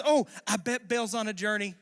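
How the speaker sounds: goading taunt. Retold or imitated. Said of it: "he's just goating it the whole time".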